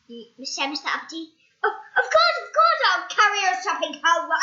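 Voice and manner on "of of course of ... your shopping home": high, loud, fast eager agreement; small eager voice. Acted. Shrunken Mister Appleseed agreeing to carry shopping. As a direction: agree quickly in a tiny voice.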